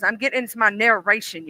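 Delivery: usual speaking voice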